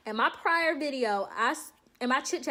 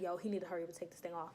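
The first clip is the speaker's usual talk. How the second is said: impatient mutter; 5 semitones lower, 11 dB quieter, much faster than usual. Retold or imitated. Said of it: "I was like".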